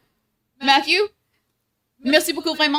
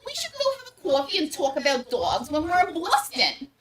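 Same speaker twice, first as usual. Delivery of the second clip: higher-pitched, hammed-up Boston vowels; mock Boston accent; 5 semitones higher than usual. Partner teasing about talking dogs over coffee, mock Boston accent.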